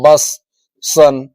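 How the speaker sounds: बस, सन with a short "uh" vowel. Shown as a demonstration. Teacher giving examples of U, short अ sound in बस, सन. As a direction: say बस and सन singly, U as short अ. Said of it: "अ बोला जा रहा है".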